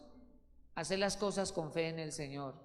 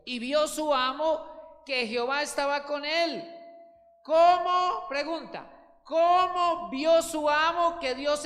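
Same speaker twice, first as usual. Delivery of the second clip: loud, high fervent preaching of scripture; preaching at full force; 7 semitones higher, 9 dB louder than usual. Acted. Preacher booming the verse to his congregation, fervent. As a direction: proclaim each verse like a call to the faithful.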